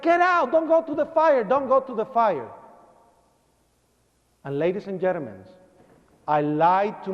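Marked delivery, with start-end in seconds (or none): 0.0-2.5 retold panicked cry that settles; screaming mother. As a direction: imitate a mother begging urgently. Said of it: "she's screaming".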